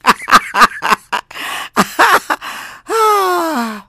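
long, high nervous laugh; drawn-out nervous cackle; nervous laughter. Real, not acted. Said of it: "Nervous laughter".

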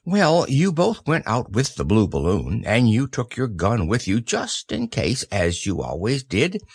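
plain reading voice

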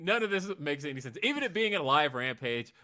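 can't believe what they booked; incredulous excitement. Real, not acted. Fan reacting to cohost's bucket-list joke, incredulous. Incredulous about Tyson on commentary.

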